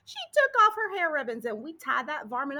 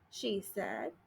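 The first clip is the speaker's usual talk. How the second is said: ordinary delivery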